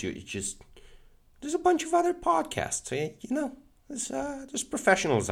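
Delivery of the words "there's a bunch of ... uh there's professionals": very high joking falsetto; squeaky silly voice. Pretend. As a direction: squeak it out like a cartoon. High put-on voice, teasing.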